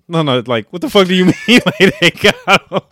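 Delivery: loud, high, laughing incredulity; retold disbelief. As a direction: demand an explanation while cracking up.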